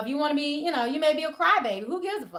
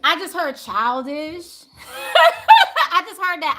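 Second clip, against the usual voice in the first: laughing; 4 semitones higher, 10 dB louder than usual. Real, not acted. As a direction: laugh through the words. Laughing at a comment.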